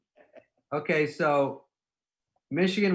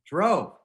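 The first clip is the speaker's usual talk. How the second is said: Drove hit higher and louder; stressed repeat; 7 semitones higher, 6 dB louder than usual. Real, not acted. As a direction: punch Drove as an echo. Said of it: "so we drove".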